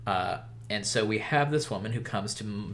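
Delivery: usual speaking voice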